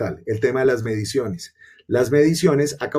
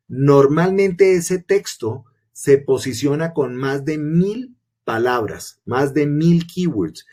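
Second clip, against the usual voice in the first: slow emphatic; slower than usual. Real, not acted. Emphasising the thousand-word rule.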